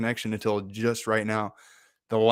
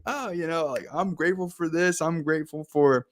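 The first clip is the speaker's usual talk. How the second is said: higher, loose and playful gratitude; 5 semitones higher than usual. Acted. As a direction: toss out thanks playfully, pitched up. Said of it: "you're kind of playful".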